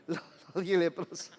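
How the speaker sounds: real laughter through a stumbled correction; laughing. Real, not acted. Laughs at own slip.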